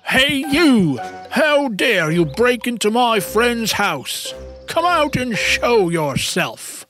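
rhino calling out the intruder, opening high; stern, indignant callout; indignant challenge. Acted. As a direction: demand that the intruder come out.

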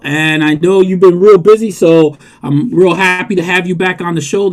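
ordinary talk

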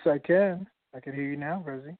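ordinary talk

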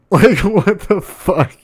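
high, slow, cracking-up disbelief; baffled disbelief. Real, not acted. Host dumbfounded that a friend killed their cat, high and incredulous. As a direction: draw it out high, utterly baffled.

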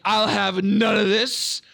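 stern refusal, slow and drawn out; Benedict. Acted. Acting Benedict's officer refusing the message.

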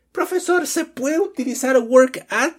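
high-pitched questioning student voice; student asking. Acted. Student asks the teacher about work at, high questioning voice. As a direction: pipe up with a high, rising question.

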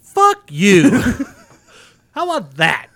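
loud laughing blurt at the ad; mock outburst. Reacting to the kids' commercial with a loud laughing curse. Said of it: "this is my reaction".

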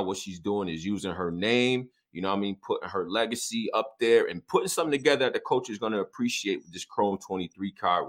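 ordinary talk, nothing marked

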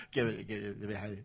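low, quiet cooing imitation; hushed low mock voice. Mimics Jamie Foxx scene.

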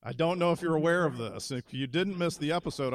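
normal talking voice

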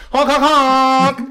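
high, loud, drawn-out honks; imitated car horn. Host honking at the ticketed driver, imitated horn. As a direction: mimic a gleeful horn blast. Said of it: "I honked my horn".

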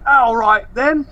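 high-pitched Aussie lad voice; brash Australian bloke boasting; Australian accent. Retold or imitated. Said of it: "your Australian accent is also on point".

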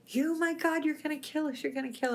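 high, frantic mock scream; panicked scream. Terrified passenger screaming at the driver, high panicked yell. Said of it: "He started screaming".

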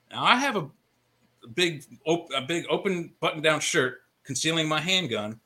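usual speaking voice

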